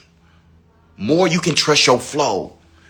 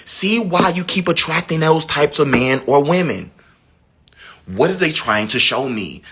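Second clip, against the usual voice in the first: ordinary delivery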